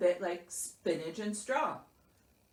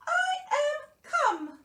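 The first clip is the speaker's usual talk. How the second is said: very high, slow little Mouse voice; squeaky Mouse declaring; 6 dB louder, slower than usual. A narrator's reading. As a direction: declare it grandly as a tiny mouse. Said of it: "declared Mouse".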